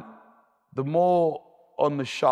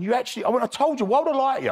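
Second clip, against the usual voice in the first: much higher, fast, triumphant I-told-you-so; vindicated exclamation; 11 semitones higher, faster than usual. Retold or imitated. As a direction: fire it back at the doubting mates, high and quick. Vindicated after mates doubted him.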